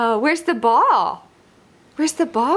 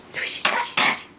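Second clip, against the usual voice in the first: whisper; 5 dB quieter, faster than usual. Pretend. Unvoiced sound play.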